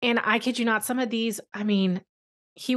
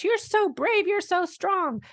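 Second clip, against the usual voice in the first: much higher, sing-song mock praise; mocking outsiders; 10 semitones higher than usual. Parent mimicking outsiders' chirpy praise, high and mocking. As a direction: parody the gushing outsider. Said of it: "when people on the outside say".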